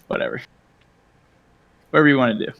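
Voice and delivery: ordinary talk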